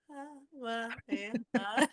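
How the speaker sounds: mock a tongue-tied, gawking fan; imitating starstruck husband. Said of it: "my husband was still looking at".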